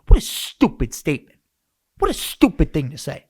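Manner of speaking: dad's angry put-down, voiced in a mock tone; imitating angry dad. Dad scoffs at his son's chat with the aunt, mock angry. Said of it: "he gets so angry".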